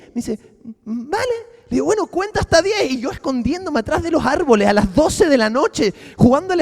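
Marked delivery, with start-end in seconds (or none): 0.8-1.5 put-on little girl voice. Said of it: "niña pequeña". 1.8-2.9 put-on higher, sing-song talk to a little kid; childlike voice. Coaxing the girl to come home, higher childlike voice. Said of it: "como una niña pequeña".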